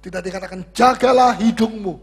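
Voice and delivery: ordinary talk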